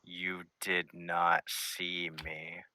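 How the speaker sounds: very slow, each word spaced; slow, hushed insistence; slow insistent denial. Acted. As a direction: drag out the denial as if it hides him. Old man hiding, slow.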